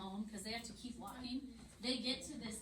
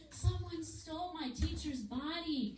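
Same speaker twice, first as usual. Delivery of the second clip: high, slow pretend wail; mock weeping; 7 semitones higher than usual. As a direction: imitate Mary crying, drawn out and high. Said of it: "break down weeping".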